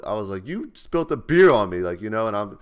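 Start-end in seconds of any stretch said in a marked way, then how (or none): 0.5-1.8 much louder and higher, confronting; retold angry shout. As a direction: shout it back at the girl who pushed. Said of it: "I think I was getting louder".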